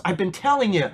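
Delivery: pleading. Retold or imitated. Drunk man warns his friend to receive Jesus, pleading. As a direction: imitate someone pleading with a friend. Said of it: "he's pleading with them".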